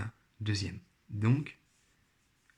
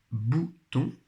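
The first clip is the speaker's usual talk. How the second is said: "bouton" split slowly in two beats; syllable by syllable; 2 semitones higher than usual. Shown as a demonstration. Teacher sounding out the picture word, syllable by syllable. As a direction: say "bouton" with a gap between the syllables. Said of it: "colorier là où les syllabes".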